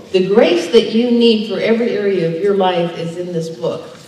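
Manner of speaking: ordinary talk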